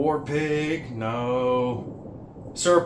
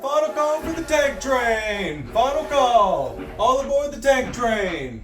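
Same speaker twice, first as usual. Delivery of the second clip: train conductor calling; 7 semitones higher than usual. Acted. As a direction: call everyone aboard the train. Conductor calling passengers aboard.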